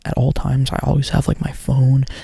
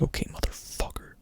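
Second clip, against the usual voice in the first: mad; 13 dB quieter than usual. Retold or imitated. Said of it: "like, mad".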